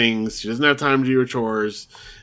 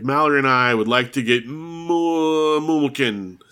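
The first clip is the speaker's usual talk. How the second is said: garbled stammer. Retold or imitated. Boyfriend asking parents about marriage, can't get it out. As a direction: start clearly, then choke on the last word. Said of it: "has a hard time getting it out".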